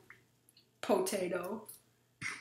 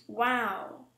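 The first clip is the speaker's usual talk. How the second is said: ordinary delivery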